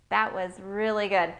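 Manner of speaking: ordinary talk, nothing marked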